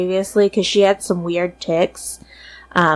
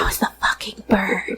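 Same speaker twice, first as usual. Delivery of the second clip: soft whisper of disbelief; whispered realization; 8 dB quieter than usual. Retold or imitated. Sister echoing Mom's realization, softer whisper. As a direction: hush it like a secret discovery.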